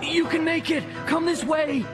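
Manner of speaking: high shouted encouragement; urgent calling. A narrator's reading. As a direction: urge a friend on from afar. Friend yelling guidance over noise.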